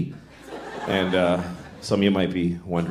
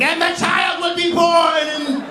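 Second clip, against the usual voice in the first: much higher, slow shrieking witch; witch prophecy; 3 dB louder, much slower than usual. Pretend. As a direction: screech it like a possessed witch.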